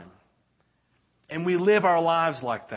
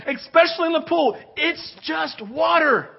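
exasperated shout; 13 semitones higher, 7 dB louder than usual. Acted. Pastor joking to the congregation, high exasperated shout. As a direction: burst out in mock exasperation.

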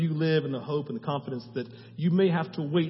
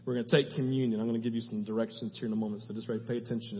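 ordinary talk, nothing marked

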